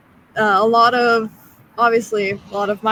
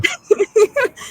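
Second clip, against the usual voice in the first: high squeaky Donald Duck quack; 13 semitones higher, 4 dB louder than usual. Pretend. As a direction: quack like a little cartoon duck. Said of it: "Little Donald in there too".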